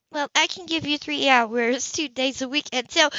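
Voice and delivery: an easygoing neighbour offering help; Grace, friendly. Acted. Narrator voices Grace.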